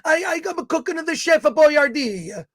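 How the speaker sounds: put-on jokey thick Italian accent; mock Italian accent. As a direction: put on a cartoon Italian chef. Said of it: "who's kind of a thick and Italian".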